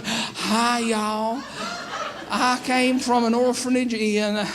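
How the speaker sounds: drawn-out Southern twang parody; mock Southern US accent. Imagining orphans introducing themselves to the audience, mock Southern accent. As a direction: tease with a put-on American Southern drawl. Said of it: "any accent they have is a wee bit of Scottish".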